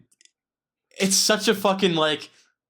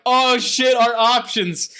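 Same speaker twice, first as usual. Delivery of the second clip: alarmed; 7 semitones higher, 4 dB louder, slower than usual. Retold or imitated. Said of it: "they're like".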